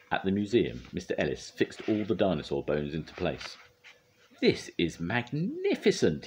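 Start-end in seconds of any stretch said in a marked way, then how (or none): 4.4-6.3 high, slow, savouring pride; drawn-out high-pitched marvelling; proud. A narrator's reading. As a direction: relish it grandly. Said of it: "he said proudly".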